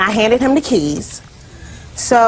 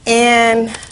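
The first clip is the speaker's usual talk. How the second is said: ordinary talk, nothing marked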